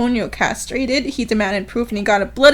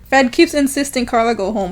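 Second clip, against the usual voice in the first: ordinary talk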